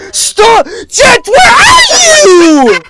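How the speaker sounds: high, stretched, wailing scream; real screaming. Real, not acted. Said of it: "scream any louder than I am now".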